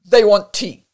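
loud, curt announcement; barked relay. A narrator's reading. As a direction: bark the order on to the room. Guttural man relays the order aloud.

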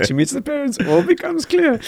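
amused high-pitched pronouncement; mock revelation. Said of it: "she says".

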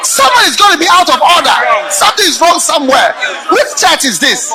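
loud, high, mocking preacher shout; preacher blasting pastors home by noon; full-force preaching. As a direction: hammer it from the pulpit.

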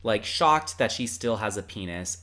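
normal talking voice

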